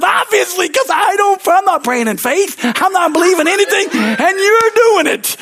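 very high, loud mock rant; acted frustrated yell; exasperated shouting. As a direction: argue back at full pitch, hammed up. Retells arguing back at God.

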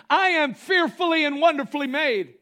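high, loud declaration; proclaiming shout. Shown as a demonstration. Pastor shows the confession people lack, shouting. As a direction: shout it out with confidence. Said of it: "We don't have this kind of".

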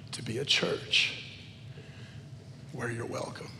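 soft, low, halting plea; real emotion, voice sunk low and soft; emotional prayer. Real, not acted. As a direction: pray quietly, overcome with feeling. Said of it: "I'm sorry for getting emotional".

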